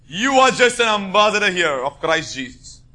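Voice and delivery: ordinary talk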